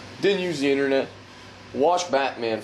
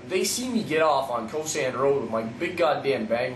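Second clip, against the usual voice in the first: ordinary delivery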